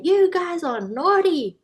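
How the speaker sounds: high, sharp pretend telling-off of the kids; mock scolding scream. Teacher screaming at her noisy class, high mock scold. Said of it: "does she scream at them and say".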